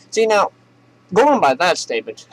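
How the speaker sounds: accidental Southern accent, swinging up in pitch. Shown as a demonstration. Comedian stuck in the voice just quoted, Southern accent. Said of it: "stuck in the goddamn Southern accent".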